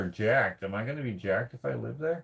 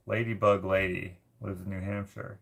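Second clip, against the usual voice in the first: ordinary delivery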